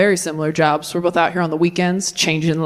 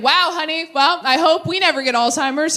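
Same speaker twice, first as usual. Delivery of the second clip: mock mom; 9 semitones higher than usual. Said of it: "she's like".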